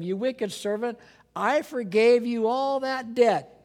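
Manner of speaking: a master scolding his servant; a stern master rebuking the wicked servant; stern master's rebuke. Acted. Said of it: "And Jesus said".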